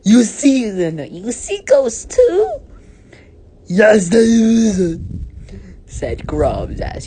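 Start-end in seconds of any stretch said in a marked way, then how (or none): 0.0-2.6 high, loud disbelieving question; hammed-up astonishment. A narrator's reading. Poopsie hears Grubs sees ghosts too, shrill-pitched mock astonishment. 3.7-5.0 high, loud, drawn-out squeal; put-on boy character. A narrator's reading. Grubs answers Poopsie about ghosts, long high squeal.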